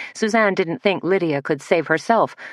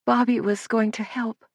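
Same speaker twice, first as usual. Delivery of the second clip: higher, tearful lament; sobbing; 7 semitones higher than usual. A narrator's reading. Girl mourning Bobby to Suzanne, tearful and higher. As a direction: let the tears break into it. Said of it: "sobbed Lydia".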